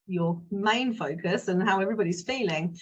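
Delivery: ordinary delivery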